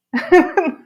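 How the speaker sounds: a sudden bright burst of laughter. Real, not acted. Laughing as she admits learning a pop song on banjo. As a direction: laugh out loud at the odd choice.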